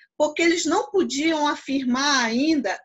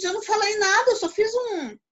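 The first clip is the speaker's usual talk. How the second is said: higher-pitched acted denial; protesting child; 8 semitones higher than usual. Child defends self to questioning mother, mild high protest. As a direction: play innocent, pitch raised.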